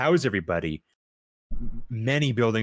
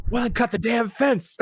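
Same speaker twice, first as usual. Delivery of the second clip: much higher, louder impatient command; mock officer order; 4 dB louder than usual. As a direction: snap the order like a fake major. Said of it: "acted dialogue".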